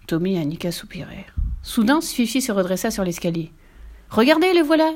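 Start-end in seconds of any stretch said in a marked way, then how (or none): 4.1-4.9 higher and louder, eager call; excited cry. A narrator's reading. Fifi spotting her father at the gate, excited call. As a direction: point and call out with delight. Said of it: "dit-elle en pointant un doigt".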